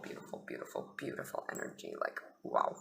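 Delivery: awed whisper. Real, not acted. Reader marveling at the energy to viewers, whispered. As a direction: breathe the words out reverently.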